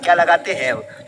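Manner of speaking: ordinary talk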